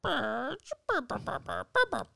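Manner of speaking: high bird burr calls; Blue's Clues dog. Acted. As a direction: chirp like a cartoon pup. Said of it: "You reminded me so much of Blue's Clues".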